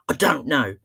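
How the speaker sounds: imitated cross little boy, mildly insistent; cross child. Seven-year-old answering the therapist, getting cross. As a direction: dig in like a fed-up child. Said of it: "He got so cross".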